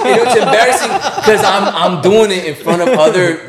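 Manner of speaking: high, loud, laughing protest; laughing embarrassment. Real, not acted. Embarrassed boast amid everyone laughing.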